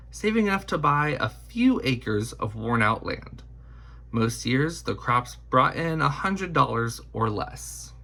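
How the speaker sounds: ordinary delivery, nothing marked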